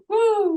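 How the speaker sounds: high, loud mock woo; whoosh woo. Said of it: "And he just went".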